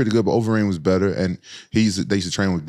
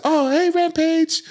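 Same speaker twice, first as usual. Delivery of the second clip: much higher, slow sing-song woman's voice; ex-girlfriend greeting; much slower than usual. Retold or imitated. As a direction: mimic her sweet drawn-out hello. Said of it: "She said".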